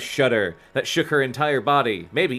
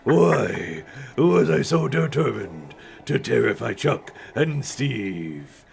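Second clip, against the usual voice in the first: dumb monster voice. Acted. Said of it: "this dumb monster voice".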